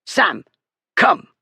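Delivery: short, snapped command; bully snapping at his dog. A narrator's reading. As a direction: order the dog to you sharply. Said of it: "Bullard snapped".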